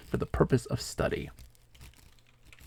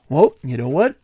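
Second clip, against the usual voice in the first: mock huffy retort, louder; imitating angry guy; 4 semitones higher, 6 dB louder than usual. Retelling the mad guy in VR, mock huffy. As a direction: ham up a sulking storm-off. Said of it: "the guy got so mad at me".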